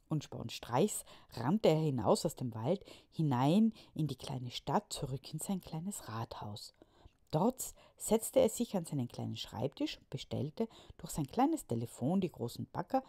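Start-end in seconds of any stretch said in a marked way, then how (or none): none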